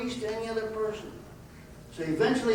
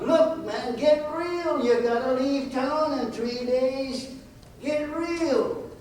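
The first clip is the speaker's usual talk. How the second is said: high, incredulous brush-off; scoffing buyers; 7 semitones higher, slower than usual. Retold or imitated. Horse buyers haggling with a man forced to leave, scoffing. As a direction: scoff at him, pitched well up. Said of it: "They laughed out loud".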